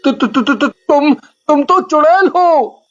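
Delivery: put-on a boy choking on the words in terror; stammering fright. Boy seeing the witch's face, stuttering in fear.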